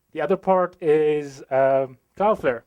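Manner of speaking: usual speaking voice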